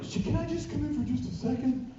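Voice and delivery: pleading fan. Retold or imitated. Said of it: "I don't, like".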